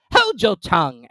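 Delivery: high, loud gruff creature; gruff Gryphon. Acted. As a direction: bluster like a rough beast.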